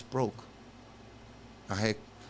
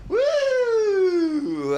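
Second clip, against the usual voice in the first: high whoop; 8 dB louder than usual. Real, not acted. Reaction whoop.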